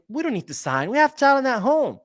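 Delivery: high, mock-proud excuse; mocking imitation. Host quoting league president's excuse for clubs not signing, mocking imitation.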